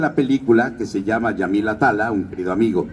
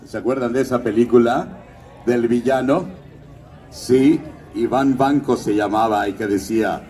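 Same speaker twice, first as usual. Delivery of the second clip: usual speaking voice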